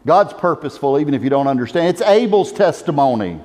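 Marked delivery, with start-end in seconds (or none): none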